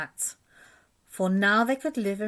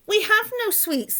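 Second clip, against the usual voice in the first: very high tiny ant voices; 4 dB louder than usual. A narrator's reading. Ants answer the robber rat, very high tiny voices. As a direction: squeak it like little insects. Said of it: "the ants replied".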